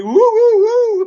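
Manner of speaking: high, loud celebratory woo; hooting high and loud; excited whoops. Real, not acted. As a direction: whoop like at a match. Cheering after near swear.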